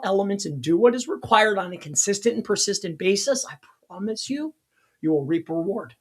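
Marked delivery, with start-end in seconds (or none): 3.5-5.9 sincere promise. Acted. Repeats his promise more softly.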